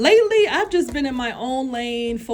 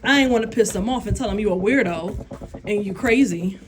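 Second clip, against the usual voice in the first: usual speaking voice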